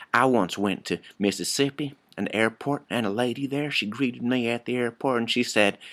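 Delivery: folksy Texas twang with extra syllables; Texas accent. Shown as a demonstration. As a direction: stretch the vowels like a Texan. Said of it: "talking like somebody from Texas".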